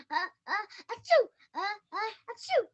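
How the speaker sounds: sneeze. Acted. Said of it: "It's sneezing".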